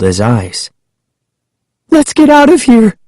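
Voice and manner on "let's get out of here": high, urgent cry; a girl's high cry to flee; alarmed cry. A narrator's reading. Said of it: "she cried".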